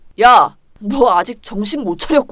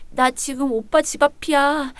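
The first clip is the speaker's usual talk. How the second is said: ordinary talk, nothing marked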